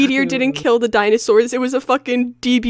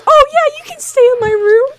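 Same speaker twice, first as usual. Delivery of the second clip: mocking ditzy voice; 10 semitones higher than usual. Mocks Anne's trust.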